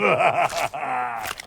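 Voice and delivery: evil laugh. Acted. Villain after gloating line.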